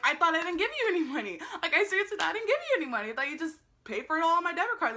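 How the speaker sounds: high-pitched, quick mock disbelief; mock incredulity. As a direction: throw it back in sarcastic amazement. Mock disbelief at cashier's money.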